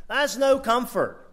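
building to a forceful climax; rousing preaching. Real, not acted. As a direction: punch the point home.

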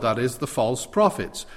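usual speaking voice

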